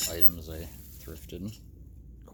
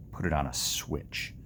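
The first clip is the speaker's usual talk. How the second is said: normal talking voice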